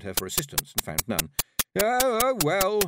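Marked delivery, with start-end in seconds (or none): 1.8-2.8 high, loud flustered dither; fluttery, at a loss; helpless. A narrator's reading. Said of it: "she said helplessly".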